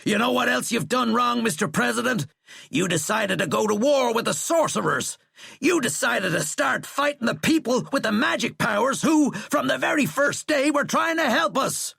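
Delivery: high, loud, mounting accusation; furious rant. A narrator's reading. General accusing the president of a wrong war, furious rant.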